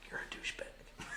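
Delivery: under-the-breath whisper; whispered jab at an uptight stranger. Retold or imitated. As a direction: whisper it as a secret insult. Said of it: "I'm like".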